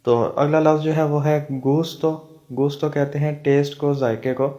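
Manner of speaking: ordinary delivery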